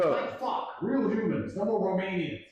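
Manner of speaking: high-pitched, outraged disbelief building to a shout; exasperated rant. Retold or imitated. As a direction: vent at the embassy like they betrayed you. Ranting at the American embassy.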